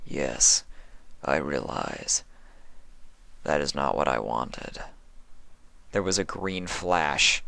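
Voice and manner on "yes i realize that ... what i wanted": quiet sorrowful admission; Sympathy, remorseful. A narrator's reading. Sympathy voiced.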